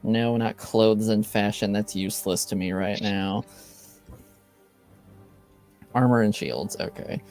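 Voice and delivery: ordinary delivery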